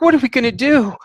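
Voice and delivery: high, helpless plea; desperate woman. Acted. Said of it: "And she's like".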